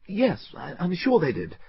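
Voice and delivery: high, faltering reply; flustered. A narrator's reading. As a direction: stumble through the lie. Clergyman stammering reply.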